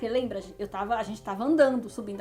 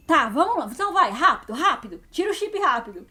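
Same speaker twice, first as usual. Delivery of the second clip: louder mock hurry; rushed robber; 3 semitones higher, 6 dB louder than usual. Robber letting her keep the chip, louder and rushed. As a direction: hurry her along, half-annoyed.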